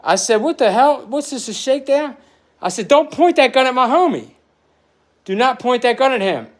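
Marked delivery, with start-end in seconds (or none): 0.4-2.1 retold defiance. Retold standoff, defiant quote. 2.9-6.5 a pitched-up warning to back off, not a full yell; warning the cop away from his friend, raised and higher; retold warning.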